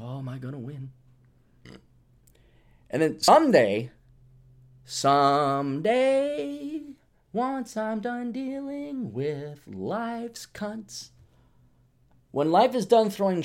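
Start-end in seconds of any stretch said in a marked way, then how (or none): 0.0-0.9 whispered aside to himself; hushed whisper. Retold or imitated. Quoting himself to listeners, hushed whisper. 4.8-11.1 very slow, soft, drawn-out wish; slow dreamy wish. Real, not acted. Dreaming aloud to listeners about retiring, slow and soft. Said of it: "dreamy drawn-out wish".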